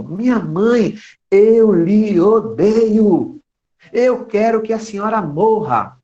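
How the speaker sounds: put-on child screaming at mother, high and loud; a raging child who has lost control; furious child. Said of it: "na hora, enlouqueceu". (criança gritando com raiva, voz aguda e alta)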